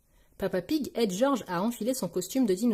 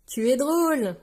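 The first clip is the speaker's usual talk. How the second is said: lightly amused, raised louder voice; a touch of mirth, no real laugh; 4 semitones higher, 5 dB louder than usual. A narrator's reading. Peppa watches Pedro's clown act, mildly amused. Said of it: "rit Peppa".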